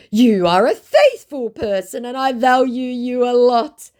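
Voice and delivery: high, loud put-on voice; gushing fake affection; mock love-bombing scammer. Scammer love-bombing her in a high mock voice.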